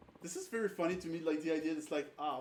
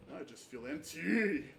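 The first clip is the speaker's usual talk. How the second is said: desperate game hero; 5 semitones higher than usual. Acted. Voicing game dialogue.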